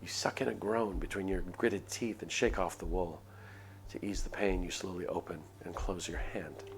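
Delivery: ordinary delivery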